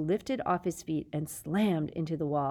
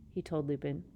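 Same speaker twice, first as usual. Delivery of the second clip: plain reading voice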